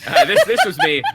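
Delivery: high, loud laughing admission. Real, not acted. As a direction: blurt it out laughing. Laughing confession.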